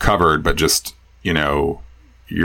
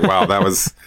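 mock amazement; 10 semitones higher than usual. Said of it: "I was like".

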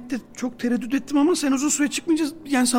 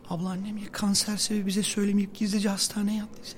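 worried; 5 semitones lower, 5 dB quieter than usual. Acted. Annesinin hasta olmasından korkuyor.

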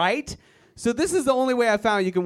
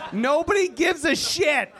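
higher, laughing yell; laughing shout; 8 semitones higher than usual. Real, not acted. Comic yelling the point again at the crowd, laughing shout. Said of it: "repeated louder".

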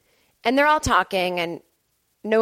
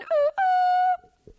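very high, held "ooh" hoot; playful falsetto ooh-ooh. Real, not acted. Hoots after plugging gifts.

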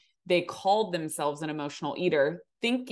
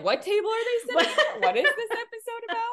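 high-pitched, laughing bewilderment; amused, squeaky-high puzzled questions; mock confusion; 5 dB louder than usual. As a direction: play baffled listeners through laughter. Mimics puzzled listeners while laughing.

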